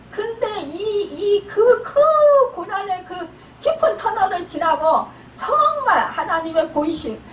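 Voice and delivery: usual speaking voice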